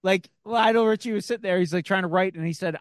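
ordinary delivery

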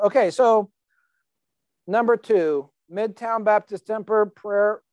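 ordinary delivery

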